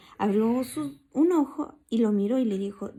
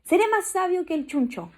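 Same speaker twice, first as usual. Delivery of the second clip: a touch higher and louder boastful mouse; proud little mouse bragging; lightly voiced proud mouse; 7 semitones higher, 4 dB louder than usual. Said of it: "continuó el cucurro".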